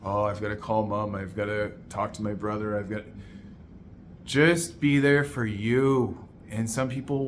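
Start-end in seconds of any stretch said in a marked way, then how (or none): 0.0-3.0 hushed, hurried inner to-do list; monkey brain chatter. Retold or imitated. Coach voicing a client's busy morning thoughts, hushed and hurried. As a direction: mutter the racing thoughts under your breath. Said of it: "the monkey brain that is tied to".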